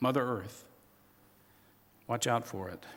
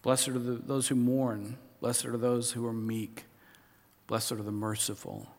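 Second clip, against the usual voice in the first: scripture reading; 4 semitones lower, 7 dB quieter than usual. Acted. Reading beatitudes slowly, softly.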